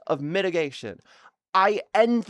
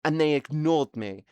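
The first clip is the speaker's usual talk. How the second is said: ordinary talk, nothing marked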